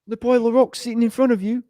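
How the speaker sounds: high-pitched Kiwi character impression; Korg. Showing friends the epitome of Kiwi accents, high Korg impression. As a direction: quote the rock creature in a high Kiwi voice. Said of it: "just listen to Korg".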